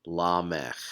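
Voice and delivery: lamech, said lah-MEH, stress on the end; lamech, ending cut off after the e. Shown as a demonstration. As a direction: lamech with no vowel after the final sound. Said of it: "is pronounced".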